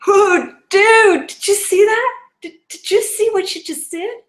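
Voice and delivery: high, loud excited exclamation; amazed friend. Retold or imitated. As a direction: burst out in amazement.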